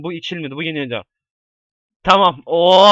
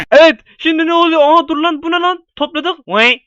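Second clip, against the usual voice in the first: ordinary delivery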